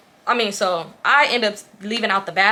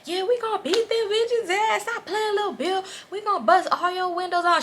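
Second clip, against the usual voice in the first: high-pitched imitation of egging on; mock friends chiming in with threats; high, squeaky backup chorus; background homegirls; 11 semitones higher than usual. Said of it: "the homegirls in the background like".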